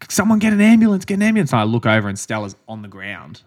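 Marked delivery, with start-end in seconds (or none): 0.1-1.5 high, loud call for an ambulance; urgent shout. Retold or imitated. Runner shouting for an ambulance, urgent yell. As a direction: yell it out for help. Calling for help after collapse.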